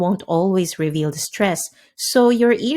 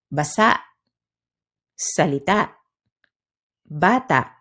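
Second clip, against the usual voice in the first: "basa, salita, bata" ending in a cut-off glottal stop; final stress, glottal stop. Shown as a demonstration. Teacher giving learners examples, "basa, salita, bata" with glottal-stopped endings. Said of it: "stressed on the last syllable and may include".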